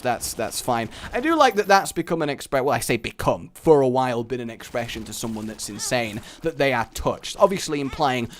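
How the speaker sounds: ordinary talk, nothing marked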